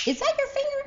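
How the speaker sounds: high, incredulous mock disbelief; astonished question. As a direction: act amazed at the snap. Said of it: "I was like".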